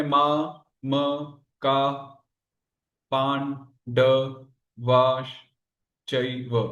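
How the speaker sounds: slow, detached syllables with a pause after each. Shown as a demonstration. Teacher moving on through the shloka's third line, syllable by syllable. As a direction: sound out each syllable of the verse separately. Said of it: "फिर आगे बढ़ते हैं".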